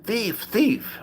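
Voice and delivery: high, lightly voiced accusing shout; a hint of an angry cook crying thief. Restaurant owner accusing him of theft, high mock cry.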